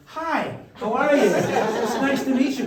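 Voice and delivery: high, loud, gushing hello breaking into laughter; hammed-up eager greeting; overeager greeting. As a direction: greet her too brightly while eyeing the painting. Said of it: "I'm going".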